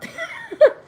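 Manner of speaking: talking through high laughter; laughing. Real, not acted. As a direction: get the words out while cracking up. Breaks into laughter mid-sentence.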